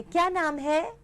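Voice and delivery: put-on echoing child. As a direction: parrot the question back, high and rising. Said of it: "आप ही के intonation और आप ही की आवाज में".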